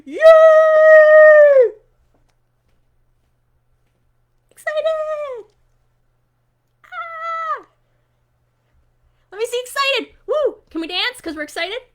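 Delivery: high, loud whoops of joy; squealing high celebration; excited. Pretend. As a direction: cheer like a kid at a party. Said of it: "Excited-ish".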